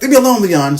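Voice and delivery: a hammed-up frightened plea; the killer yelling at his hallucination, mock-panicked; freaking out. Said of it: "He starts freaking out".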